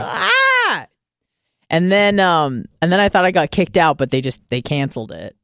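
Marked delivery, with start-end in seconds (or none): 0.0-0.9 squeaky frustrated yelp; a high yelp of overload; high overwhelmed yelp. Retold or imitated. Retold overwhelmed reaction.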